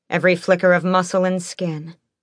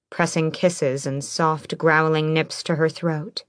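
usual speaking voice

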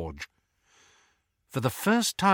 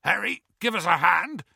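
hoarse, higher-pitched call for help; 9 semitones higher than usual. A narrator's reading. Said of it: "called Hagrid hoarsely from the door".

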